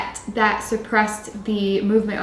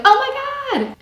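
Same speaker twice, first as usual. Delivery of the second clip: high excited squeal; 3 dB louder than usual. Shown as a demonstration. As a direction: jump way up high on Oh my God. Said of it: "It's either really low or like".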